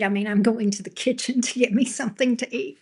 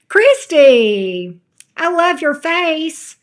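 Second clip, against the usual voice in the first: louder, higher, slow gush; 6 semitones higher, 7 dB louder, much slower than usual. Real, not acted. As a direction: beam it out to a favorite viewer. Gushing at viewer.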